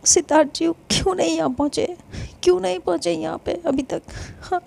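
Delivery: ordinary delivery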